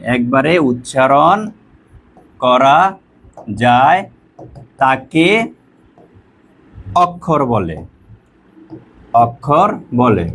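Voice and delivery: slow, word by word with long pauses; spaced-out dictation, leaving time to write; dictation pace. Shown as a demonstration. Teacher dictating the definition to students, slow dictation. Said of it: "লিখে নিবা".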